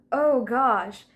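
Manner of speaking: ordinary talk, nothing marked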